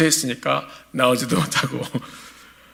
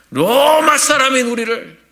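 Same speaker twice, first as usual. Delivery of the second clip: emphatic sermon cry; 10 semitones higher, 7 dB louder, slower than usual. Acted. Preacher's emphatic outburst.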